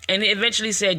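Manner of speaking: ordinary talk, nothing marked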